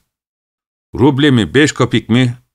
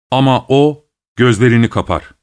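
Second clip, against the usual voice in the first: usual speaking voice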